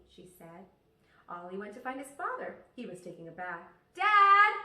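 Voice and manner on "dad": loud, high call across the house; yelling. Acted. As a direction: call out to dad in the bath. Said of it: "Ollie yelled".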